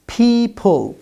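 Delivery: "people" with two puffed P sounds; slow, careful "people", P not B; clear P sounds. Shown as a demonstration. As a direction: model "people" with aspirated P. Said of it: "It's not Bible".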